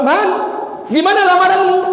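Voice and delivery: high, loud eager teacher; eager question. Acted. Teacher asking Sulaiman about his proposal, high and loud. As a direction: ask with big eager interest.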